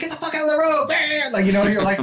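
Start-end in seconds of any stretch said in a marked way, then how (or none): 0.0-0.9 imitating a driver yelling, pitched much higher; mock angry driver. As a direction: squawk it like a furious driver in the road.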